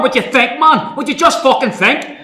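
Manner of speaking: exasperated angry rant. Acted. Officer berating soldier.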